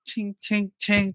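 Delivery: high-pitched metallic "ching" noises; imitated knife clinks. Acting out two kids' kitchen knife fight, high clinking sounds. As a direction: mimic blades clashing, high and ringing.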